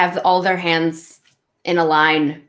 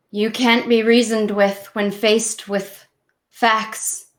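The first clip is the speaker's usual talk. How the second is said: slightly raised, deliberate speech with long pauses; reproachful sibling in confrontation; 4 semitones higher than usual. Acted. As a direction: lay out the reproach piece by piece. Player speaks in character to sister.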